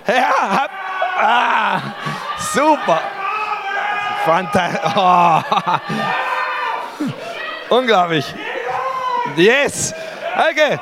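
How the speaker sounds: high, laughing cheer; laughing excited shout. Real, not acted. Preacher delighted by the shouting crowd, laughing cheer. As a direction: cheer them on through a laugh.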